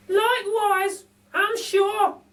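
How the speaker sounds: very high, slow, chirpy lady voice; a cheeky cockney burglar lady; Burglar Betty. A narrator's reading. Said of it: "Burglar Betty says".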